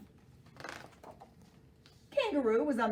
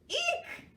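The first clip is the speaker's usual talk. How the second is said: very high eek; squeal. Acted. Cheetah swinging into Elephant, a high squeal. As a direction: squeal as you crash.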